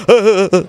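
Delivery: short high taunting laugh; mock laugh. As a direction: laugh at them scornfully. Mocking the excuse. (tawang nanunuya)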